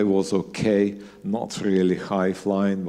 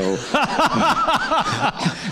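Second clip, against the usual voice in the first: big high laugh. Real, not acted. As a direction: burst of high-pitched laughter. Joking about Manchester rain.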